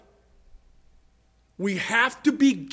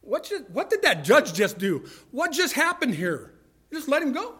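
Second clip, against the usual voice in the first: incredulous, pitch swooping high; high-pitched disbelief at the ruling; baffled onlookers; 4 semitones higher than usual. Acted. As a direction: act out a stunned courtroom gallery. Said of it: "everybody else is sitting around going".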